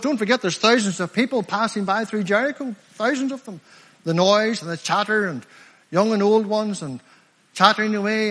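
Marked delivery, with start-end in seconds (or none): none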